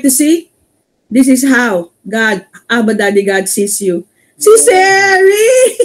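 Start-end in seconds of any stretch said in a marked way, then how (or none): 4.4-5.8 high, loud, slow, laughing; laughing shout. Real, not acted. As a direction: call out her name laughing. Excited laughing shout at congregant.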